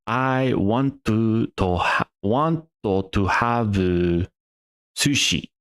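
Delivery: slow, drawn-out Japanese-accented English. Shown as a demonstration. Repeating the Japanese learner's answer, slow Japanese accent. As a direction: stretch the syllables in a Japanese accent. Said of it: "that's not how Japanese works".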